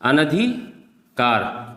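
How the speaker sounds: अनधिकार drawn out very slowly, each syllable clear; slow clear repeat. Shown as a demonstration. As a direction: spell out अनधिकार slowly for the class. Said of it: "क्या होता है?". (अनधिकार को बहुत धीरे साफ़ बोलना)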